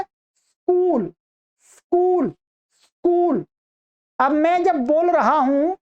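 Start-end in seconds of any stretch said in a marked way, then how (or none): 0.0-3.4 स्कूल said slowly and clearly, s+k cluster; correct pronunciation. Shown as a demonstration. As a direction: model स्कूल for students, no vowel before स. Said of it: "ये सही इसका उच्चारण क्या है". ("स्कूल" धीरे और साफ़, बिना "इ" या "अ" के)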